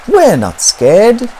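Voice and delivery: ordinary delivery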